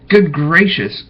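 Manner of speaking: startled gasp. A narrator's reading. Maisie sees Horton, gasps in surprise. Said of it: "gasped Maisie".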